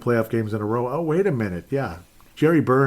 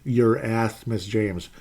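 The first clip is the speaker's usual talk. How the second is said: ordinary delivery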